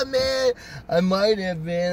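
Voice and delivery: slow stoner laugh-talk; put-on druggy chuckle and drawl; imitated stoner buddy. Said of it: "They're high out of their mind".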